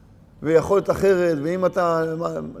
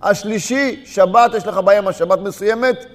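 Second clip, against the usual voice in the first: fiery preaching; 3 semitones higher, 5 dB louder than usual. Acted. Preacher urging congregation on their faults, loud preaching. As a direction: hammer each point to the congregation. Preacher builds to full force.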